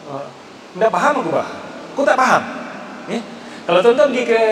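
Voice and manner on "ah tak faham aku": ordinary talk, nothing marked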